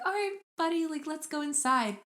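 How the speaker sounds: high, coaxing mom voice; gentle mom. Retold or imitated. Retelling mom leading her little boy inside, coaxing.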